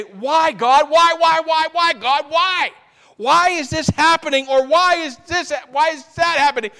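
acted shouting, very high and loud; anguished mock pleading. Weary believer demanding answers from God, acted anguished shouting. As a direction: hurl repeated desperate questions upward, hammed-up. Acts out people crying to God.

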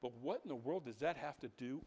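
mildly mock the doubting congregation; imagined listener. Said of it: "You're sitting there thinking".

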